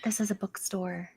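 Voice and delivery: curt whispered reply. Acted. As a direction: hush it flatly.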